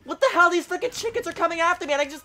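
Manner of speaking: high and loud, panicky squawks of protest; outraged disbelief. Real, not acted. Gamer panics at the chickens chasing him, high and loud. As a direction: freak out at the chickens.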